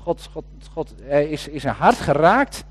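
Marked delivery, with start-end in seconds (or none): 0.0-0.9 whispered. Real, not acted. Preacher overcome, repeating God's name.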